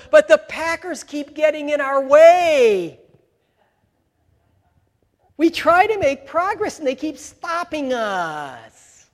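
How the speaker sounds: football player whining to his coach about giving up; whiny quitter. Pretend. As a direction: whimper your excuses to the coach. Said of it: "talking like this".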